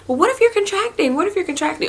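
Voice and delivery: high insistent mom voice; nagging mom. Retold or imitated. Mom pestering her daughter in hospital, high insistent nag. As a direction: badger her like a worried mother. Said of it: "my mom just kept saying".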